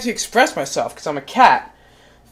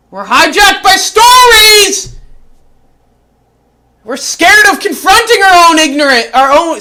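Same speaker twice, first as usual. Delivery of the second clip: shouted sermon; shouting; 7 semitones higher, 7 dB louder than usual. Acted. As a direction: yell it like a preacher.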